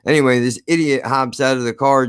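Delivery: ordinary talk, nothing marked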